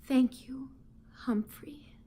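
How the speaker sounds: soft near-whisper of gratitude; whispered thank you to the hamster; quiet thanks. Acted. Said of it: "quietly said".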